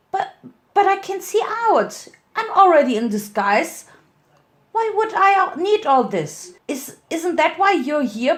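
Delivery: put-on high, whiny child voice, stammering; a spoiled little girl pushing back, high and insistent; pleading little filly. A narrator's reading. Winter argues with Lancer about the windows, high childish pleading.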